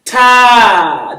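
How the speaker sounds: ta held long and loud; ta, lengthened vowel; elongated ta. Shown as a demonstration. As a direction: stretch the ta out. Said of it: "The ta is elongated".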